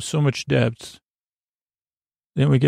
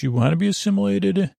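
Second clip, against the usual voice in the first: lightly voiced, higher childlike Borg, a bit quick; mock Hugh voice; 7 semitones higher, faster than usual. Recapping the episode, host imitates Hugh answering Guinan in a higher voice. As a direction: imitate Hugh's innocent question in a higher voice. Hugh's reply, retold Borg scene.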